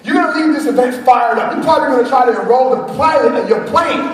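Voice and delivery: rally the room like a revival preacher; preaching to the crowd. Acted. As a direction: speaker firing up the audience, in full preaching voice. Motivational preaching.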